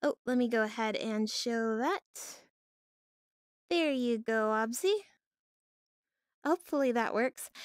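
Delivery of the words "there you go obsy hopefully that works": normal talking voice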